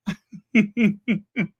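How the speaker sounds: high rippling chuckle; giggle. Real, not acted. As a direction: chuckle after your own joke. Laughs after own sung joke.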